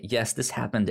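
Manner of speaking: normal talking voice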